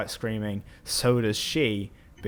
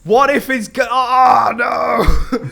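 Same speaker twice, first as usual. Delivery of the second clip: high, loud laughter breaking through the words; cracking up, too loud to finish; laughing loudly; 13 semitones higher, 14 dB louder, slower than usual. Real, not acted. Narrator cracks up at his own joke, laughing loudly. Laughing fit mid-story.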